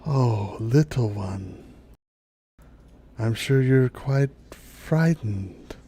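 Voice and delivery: slow, hushed dryad with long pauses; soothing dryad. Acted. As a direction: comfort a small one, very unhurried. Dryad soothes the little visitor.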